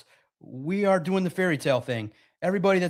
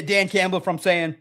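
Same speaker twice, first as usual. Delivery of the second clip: ordinary talk, nothing marked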